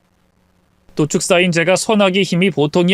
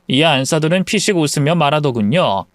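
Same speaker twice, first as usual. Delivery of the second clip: usual speaking voice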